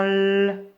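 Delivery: L held long as "lll"; isolated L sound. Shown as a demonstration. Teacher asking pupils to locate it, long L. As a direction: draw out the bare consonant L. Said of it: "Où c'est que j'entends L?".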